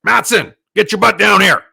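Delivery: acted gruff summons at full volume; imitated angry shout. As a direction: play the boss yelling an order down the phone. Client's angry phone call imitated.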